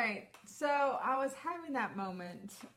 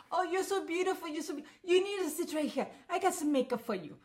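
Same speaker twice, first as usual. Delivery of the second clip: high, fast, badly faked Israeli saleswoman; bad Israeli accent; 8 semitones higher, faster than usual. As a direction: overdo a kiosk seller's Israeli accent, badly. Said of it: "my accent's gonna be really bad".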